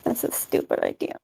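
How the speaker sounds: flat whispered put-down. Acted. Minnie dismissing Corey's idea in a whisper. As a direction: breathe it out as a sulky whisper. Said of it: "says, like".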